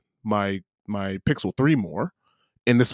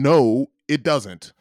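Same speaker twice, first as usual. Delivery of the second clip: slow, raised-pitch retold refusal; emphatic, drawn-out "No", then deliberate "it doesn't"; insistent contradiction; 4 semitones higher, 4 dB louder, much slower than usual. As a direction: stretch "No" out, standing your ground. Retells standing his ground against her.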